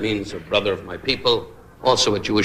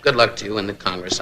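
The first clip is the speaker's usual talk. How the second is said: normal talking voice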